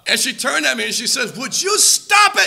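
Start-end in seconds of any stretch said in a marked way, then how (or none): 1.4-2.5 high, slow mock yell at her husband. Wife yelling at husband over the dish, loud and slow. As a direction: snap it out like an angry wife. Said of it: "she started yelling at me".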